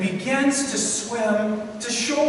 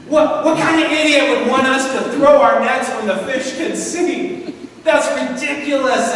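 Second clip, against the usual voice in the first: raised, higher-pitched mock indignation; incredulous scoffing; 5 semitones higher, 4 dB louder than usual. Peter complaining to his fishing friends, incredulous. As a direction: scoff at an absurd suggestion.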